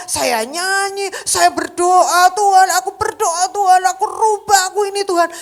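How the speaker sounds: retold wrestling prayer, loud and high; fervent crying prayer. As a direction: beg God to change you. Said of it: "saya bilang gini".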